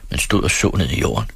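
ordinary delivery, nothing marked

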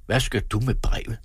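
usual speaking voice

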